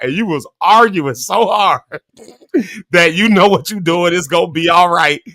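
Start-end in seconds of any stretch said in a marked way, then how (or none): none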